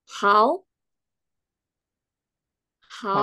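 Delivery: "How" rising like a question; second-tone demo. Shown as a demonstration. Teacher asking class to repeat, rising "How". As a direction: lift the end of "How". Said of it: "kita coba dulu dengan nada kedua".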